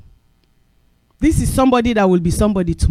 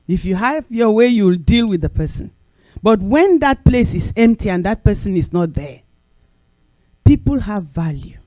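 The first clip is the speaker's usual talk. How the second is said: usual speaking voice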